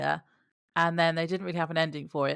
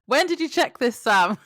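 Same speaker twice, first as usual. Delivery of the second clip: mock accusing shout; 6 semitones higher, 7 dB louder, slower than usual. Mock accusing question to Sam.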